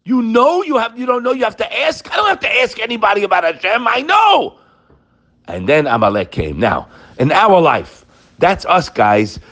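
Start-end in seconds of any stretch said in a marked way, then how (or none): 0.0-4.6 mock scream. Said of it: "So Hashem screams".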